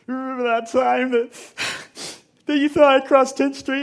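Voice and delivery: high, loud, tearful voice; crying confession. Retold or imitated. Son confessing to dad in bed, crying. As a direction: sob it out to dad. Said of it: "and I was crying".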